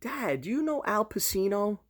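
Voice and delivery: high-pitched imitation of a daughter; daughter's voice. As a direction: imitate a teen girl asking dad. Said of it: "She's like".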